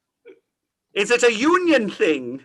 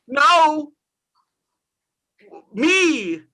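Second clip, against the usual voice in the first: normal talking voice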